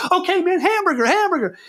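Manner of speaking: very high, half-laughing hamburger; frightened actor giving in to the hamburger safe word; panicked laughing plea. Retold or imitated.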